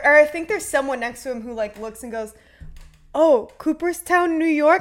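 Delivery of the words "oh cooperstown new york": imitating a giddy daughter, higher and slow; excited. Daughter spotting the Cooperstown call, mock-excited. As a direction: mimic her thrilled gasp at the caller ID. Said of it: "she's all excited".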